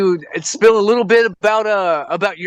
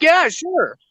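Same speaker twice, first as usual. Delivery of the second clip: lightly voiced casual yes, sure; casual agreement; 8 semitones higher than usual. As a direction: toss off a high easy yes. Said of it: "But he was like".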